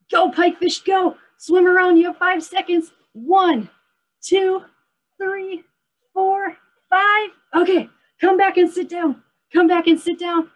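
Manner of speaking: high, loud calls across the room; calling out. Real, not acted. Teacher calling children during a chase game, loud high calls.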